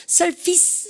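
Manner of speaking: surface said as "SUR-fis", short second syllable; correct surface. Shown as a demonstration. Teacher modelling the word for students, surface pronounced SUR-fis. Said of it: "surface 아니에요".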